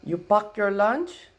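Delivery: put-on high, slow Filipino-accented question; grandma's Filipino accent. Grandma asking her grandson about lunch in a Filipino accent. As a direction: mimic grandma's thick Filipino accent, drawn out. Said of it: "And my grandma goes".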